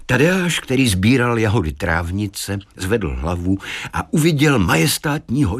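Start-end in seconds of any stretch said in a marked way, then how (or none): none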